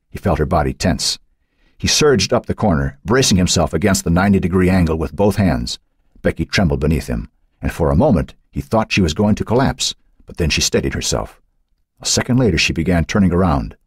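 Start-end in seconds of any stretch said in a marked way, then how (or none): none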